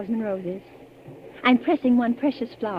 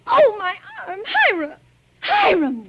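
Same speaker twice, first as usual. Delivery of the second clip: louder frightened cries of pain, calling his name; a bride crying out to her husband as he grips her arm too hard; 8 semitones higher, 6 dB louder, slower than usual. Acted. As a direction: cry out as he wrenches your arm. Husband grabs her arm roughly.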